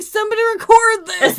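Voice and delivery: high, loud, laughing call to record; excited yell. Retold or imitated. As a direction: shout it out gleefully while laughing. Friend excited at ghost talk.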